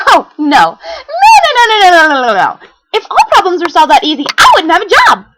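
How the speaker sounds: very high, loud frantic protest; frantic high shout. Acted. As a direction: cry no over and over. Pinkie protests loudly.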